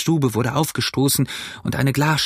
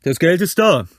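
loud, raised call down; higher, projected reply; shouted answer; 6 semitones higher, 5 dB louder than usual. A narrator's reading. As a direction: holler the news from a window. Man shouts answer from window.

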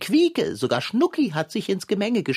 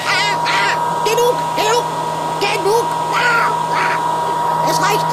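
hoch und laut gegen den Lärm anschreien; gequälter Schrei; 13 semitones higher, 8 dB louder than usual. Acted. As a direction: schrei den lauten Föhn an, er soll aufhören. Lauter Föhn trocknet Teig. (laut und hoch gegen den Föhn anbrüllen)

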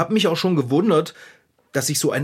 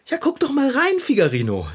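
lightly voiced Lotte; 9 semitones higher than usual. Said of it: "Lotte meinte".

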